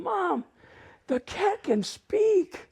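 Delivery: high, drawn-out amazed announcement; gullible kid. Retold or imitated. Gullible boy running to mom, high-voiced amazement. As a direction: break astonishing news to your mother. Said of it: "like the dummy that I am".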